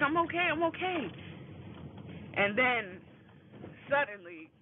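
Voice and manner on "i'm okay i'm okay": high-pitched imitation of mom insisting; high, insistent retold reassurance. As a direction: lightly act out a hurt mom reassuring kids. Mother retold reassuring worried kids.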